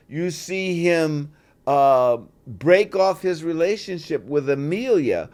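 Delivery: normal talking voice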